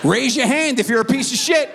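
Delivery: hammed-up shouted question; mock shout. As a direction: yell it like a crazy teacher. Mocking mom's phone call.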